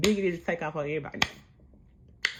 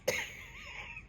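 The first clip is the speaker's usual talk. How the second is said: high squealing laugh; 9 dB quieter than usual. Real, not acted. Squealing laugh at Biggie's miss.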